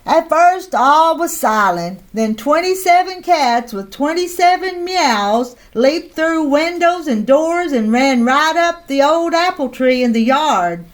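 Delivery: usual speaking voice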